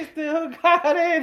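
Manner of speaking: high, slow mock catchphrase voice. Their all-purpose joke voice backing up the co-host, high and slow. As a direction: push it up high and drag it out. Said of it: "That's our voice for everyone".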